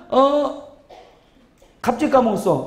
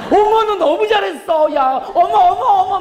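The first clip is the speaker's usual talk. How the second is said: high, giggly over-the-top fussing; hammed-up delight, piling on the praise; gushing praise; 9 semitones higher than usual. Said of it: "I 유형은 계속 해줘야 돼". (호들갑스럽게 칭찬하는 흉내)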